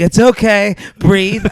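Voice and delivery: loud, high, strained reassurance; imitated urgent father. Hurt father telling his scared son to breathe, loud retold urging. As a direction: act out dad forcefully calming a kid.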